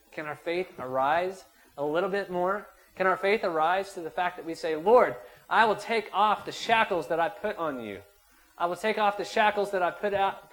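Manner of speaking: loud, high preaching prayer building; fervent preaching prayer. Acted. Preacher leading the church in a fervent prayer. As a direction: pour out the prayer to the Lord at full force.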